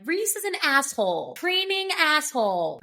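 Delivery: high-pitched quote of an iconic insult; [name]. Retold or imitated. Quoting the iconic line about Reese, high-pitched insult. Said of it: "the iconic Natalie line".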